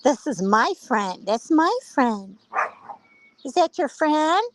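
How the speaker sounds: high, drawn-out voice for a jealous dog; possessive dog. Acted. Dog guarding her friend at the fence, possessive. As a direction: stretch it out high, claiming your friend. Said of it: "She's like".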